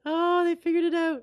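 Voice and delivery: high, playful exclamation; mock amazement. Listener joking about millennials given a device, mock amazement.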